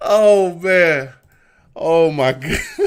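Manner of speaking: loud, high exclamations through laughter; can't stop laughing; cracking up out loud. Real, not acted. Laughing fit after shout-out.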